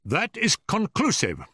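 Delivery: high-pitched, indignant pronouncement; angry. A narrator's reading. Professor glares at Holmes after assistant's answer, angry. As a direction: declare the case closed, angrily. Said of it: "glaring angrily at my companion".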